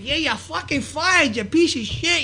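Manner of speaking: high, drawn-out mock tirade; angry firing rant. Promoter firing wrestlers in the back, high ranting voice. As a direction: imitate an irate boss sacking someone. Acting out Sparta firing him.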